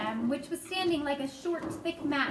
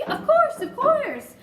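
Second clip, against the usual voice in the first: high, loud, laughing boast; tossing it off with a laugh; 13 semitones higher, 8 dB louder, slower than usual. Acted.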